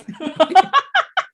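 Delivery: shrieking laugh way up high; cracking up at her own joke; loud high laugh. Real, not acted. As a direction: burst into a squealing laugh. Laughing at herself.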